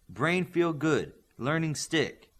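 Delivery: put-on teasing the big boy in slow caveman grunts; a friend mocking the big boy's simple speech in slow caveman talk.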